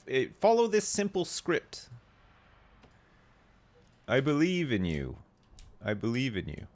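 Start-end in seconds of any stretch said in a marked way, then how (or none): none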